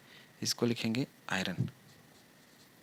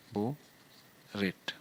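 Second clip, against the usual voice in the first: Borate drawn out slowly, long gap between बो and रेट; syllable-by-syllable dictation; much slower than usual. Shown as a demonstration. Teacher writing out the compound's name, borate slowly split in two. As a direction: say borate in two separated pieces, बो, then रेट.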